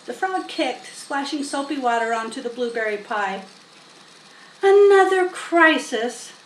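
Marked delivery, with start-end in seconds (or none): 4.6-6.3 groaning. A narrator's reading. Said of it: "groaned Fern".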